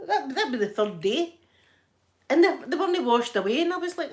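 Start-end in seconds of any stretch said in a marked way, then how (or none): none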